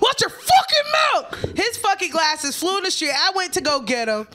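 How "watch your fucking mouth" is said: high, loud, furious shout; furious yell. Retold or imitated. Brittany yelling at the boyfriend as she hits him, furious shout. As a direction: scream it as she swings. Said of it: "she hit".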